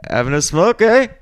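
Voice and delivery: lightly put-on Canadian accent; a hint of Canadian, "eh?" rising. Telling bandmates about Canadians at the smoke break, light Canadian accent. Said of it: "I'm like".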